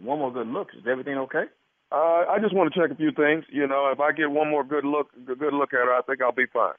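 lightly voiced casual physician; a hint of a doctor's measured patter; put-on doctor. Prankster posing as gynecologist to the husband, casual.

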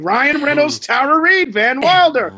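loud, high-pitched outburst; emphatic correction. Real, not acted. Co-host correcting the name mix-up, emphatic and loud.

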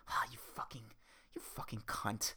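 real hissed curse under the breath; whispered cursing. Real, not acted. Cursing his untied shoelaces in a whisper. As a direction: swear at the shoelace in a fierce whisper. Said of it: "My shoelaces are untied".

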